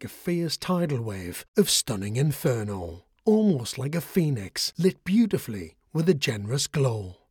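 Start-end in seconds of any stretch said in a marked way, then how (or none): none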